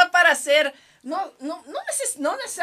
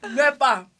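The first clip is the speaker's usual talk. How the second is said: ordinary delivery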